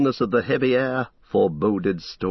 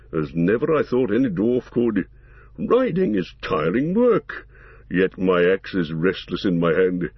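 gruff gravelly dwarf voice; 2 semitones lower than usual. Acted. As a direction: growl it out like a stout dwarf. Gimli the dwarf speaks.

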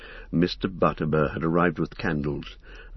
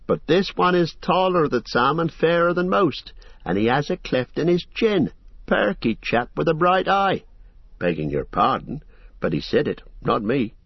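fussy innkeeper; 9 semitones higher than usual. Acted. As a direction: chatter on like a busy, apologetic landlord. Landlord voiced in the story.

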